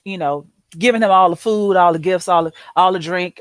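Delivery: ordinary talk, nothing marked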